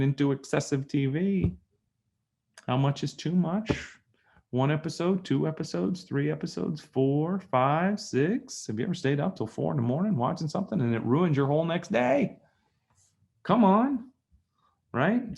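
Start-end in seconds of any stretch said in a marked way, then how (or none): 2.7-12.4 higher, teasing count up the episodes; incredulous rhetorical questions. Acted. Speaker teasing viewers about Netflix binges, higher and teasing. 13.4-15.4 high, loud playful jab; teasing come on. Acted. Speaker teasing viewers caught bingeing, high playful jab.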